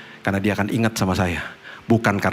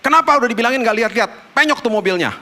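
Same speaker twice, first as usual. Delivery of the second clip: fast, high, loud scolding; angry scolding; 13 semitones higher, 4 dB louder, faster than usual. Retold or imitated. Father scolding his son over the dented car, fast, high and loud. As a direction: snap at the kid fast and high. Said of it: "marah-marah sama dia".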